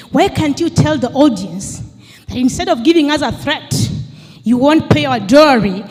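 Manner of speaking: ordinary talk, nothing marked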